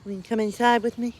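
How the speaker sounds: high sing-song voice for the dog; talking to a dog. Real, not acted. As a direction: coax the dog inside sweetly. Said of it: "I was talking to the dog".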